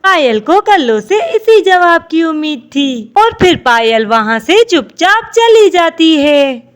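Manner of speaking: ordinary delivery, nothing marked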